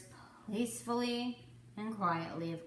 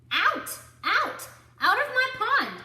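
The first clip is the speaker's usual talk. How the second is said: very high, loud yelling; furious shout; 9 dB louder than usual. Acted. Duck ordering the animals out of his pond, furious shout. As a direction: drive them all out. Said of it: "cried Mister Duck".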